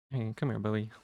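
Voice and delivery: usual speaking voice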